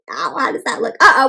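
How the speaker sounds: plain reading voice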